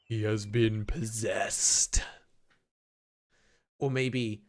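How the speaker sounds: very low, slow doom-laden pronouncement; mock ominous. Player declaring the boy possessed, mock ominous. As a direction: drop deep and draw it out like a horror verdict.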